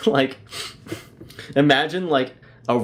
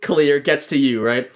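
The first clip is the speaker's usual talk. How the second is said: ordinary talk, nothing marked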